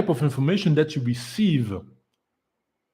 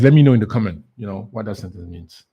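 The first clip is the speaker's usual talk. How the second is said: ordinary delivery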